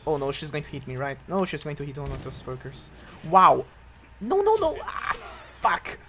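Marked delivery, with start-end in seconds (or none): 3.3-6.0 very high, loud panicked no; desperate shout. Real, not acted. Gamer failing a rescue in time, desperate shout. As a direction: cry out as it goes wrong. About to die.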